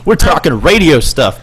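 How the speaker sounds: ordinary talk, nothing marked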